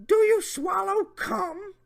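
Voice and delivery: high, slow, hammed-up creepy voice; Jeff Stacy voice. Mocking the predator's message, slow high voice. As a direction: drag it out in a high mock leer. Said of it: "my Jeff Stacy voice".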